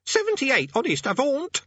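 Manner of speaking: very high caught-out squeak. Acted. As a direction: squeak it out defensively. Boy caught lying about his age.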